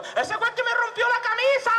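piercing very high cry; accusing yell. Acted. Shirt's owner finds the teller after the fight, high yell. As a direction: wail the accusation, stretching the end. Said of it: "Y gritó el tipo".

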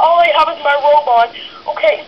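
ordinary talk, nothing marked

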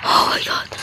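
breathless whispered oh my god; shocked whisper. Real, not acted. After a scare.

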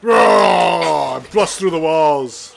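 loud slow monster growl; monster roar. Acted. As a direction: roar like a wall-smashing beast. Playing a smashing monster.

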